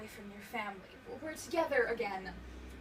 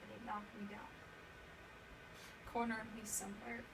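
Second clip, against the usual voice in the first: ordinary delivery, nothing marked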